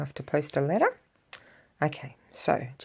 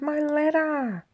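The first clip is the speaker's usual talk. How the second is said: distressed cry; 11 semitones higher, slower than usual. Acted. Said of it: "she cried".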